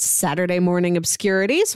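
usual speaking voice

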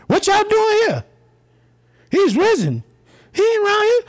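very high-pitched calling out, playfully acted; high angel call. Acting out angels at the tomb.

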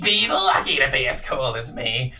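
loud, cocky little creature; brash boast. Acted. Little one with the hat boasts, loudly cocky.

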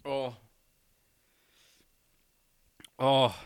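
talking through a burning mouth; words slurred by a burning tongue; burned tongue. Real, not acted. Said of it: "How are you talking right now? I can't move my mouth".

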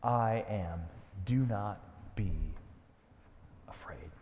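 gentle, slowing, trailing into a whisper on afraid; soft reassurance fading to a whisper. Acted. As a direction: hush them, don't be afraid. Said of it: "But the way he says it is".

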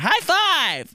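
high, loud sing-song high five; mock chirpy high five. Imitating how people say high five, high sing-song. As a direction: squeal it like a peppy stranger. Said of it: "they always say it like that".

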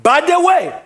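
high-pitched, emphatic sermon delivery; emphatic preaching. Real, not acted. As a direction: preach it with conviction, stretching key words. Preaches on kingdom of God.

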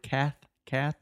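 cath, cath with the th sound; teaching th sound. Shown as a demonstration. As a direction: repeat cath with a clear th. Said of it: "put a T H sound".

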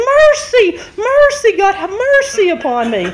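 loud, high cry that eases off by the end; comic wail to God; screamed plea. Retold or imitated. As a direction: reenact a panicked prayer for mercy. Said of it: "when you're screaming".

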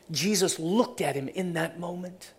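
ordinary talk, nothing marked

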